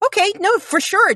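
high-pitched, over-accommodating reply; mock agreeable, pitched well up; imitated breezy agreement. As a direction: put on an eager-to-oblige voice. Mimics an accommodating work-from-home reply.

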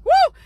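high, loud "woo"; excited whoop. Real, not acted. Preacher punctuating a point, excited whoop. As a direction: let out a sudden high whoop.

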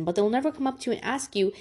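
ordinary talk

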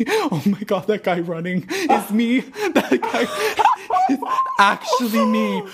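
squealing with laughter, pitch jumping up; cracking up mid-sentence; laughing. Real, not acted. Laughing at a runner outside.

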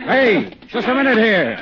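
high, loud shout down the alley; loud, high call to break up a fight. Acted. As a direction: yell to stop the beating. Calls out at alley beating.

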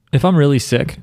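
normal talking voice

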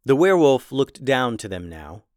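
ordinary delivery

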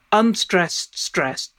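ordinary delivery

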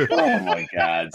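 laughing in disbelief. Real, not acted. Laughing at Nintendo answer.

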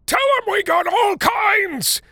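put-on slow, high, loud old man's shout. A narrator's reading.

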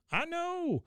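high mock-wailing boy; hammed-up devoted grandson calling back; Bobby. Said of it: "He goes".

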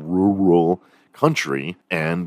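ordinary talk, nothing marked

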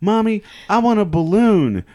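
lightly voiced kid begging mom; little kid voice. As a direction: squeak it like a small kid. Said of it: "little kid goes".

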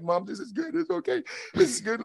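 high, strained reassurance to mom; retold tearful reassurance. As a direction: imitate reassuring her through tears. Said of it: "And I started crying".

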